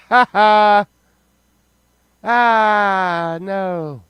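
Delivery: high, loud fake cackle; mock spooky laugh. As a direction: ham up a creepy laugh.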